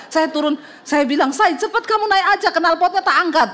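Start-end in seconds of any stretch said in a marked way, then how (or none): 1.3-3.5 high-pitched urgent command; acted urgency. Wife hurries husband up the ramp, urgent high voice. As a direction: re-enact hurrying someone along, voice raised high.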